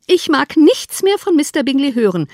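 high, loud outburst; vexed cry. A narrator's reading. Wife sick of hearing about Bingley, vexed cry. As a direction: burst out, fed up with the subject. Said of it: "rief Misses Bennet".

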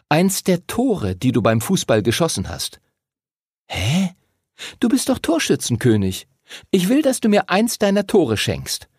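keckes Mädchen, höhere Stimme; fordernde Lucinda. A narrator's reading. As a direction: mit angehobener Stimme einen Wunsch durchsetzen. (forderndes Mädchen, leicht gespielt)

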